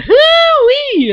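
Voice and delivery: high, loud hammed-up cowboy yell; cowboy whoop. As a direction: whoop it out like an over-the-top cowboy. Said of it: "so over the top in their cowboyness".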